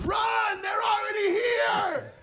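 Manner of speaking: high, loud, slow frantic shout; panicked yelling. Retold or imitated. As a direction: scream a warning to everyone. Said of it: "what I actually said was".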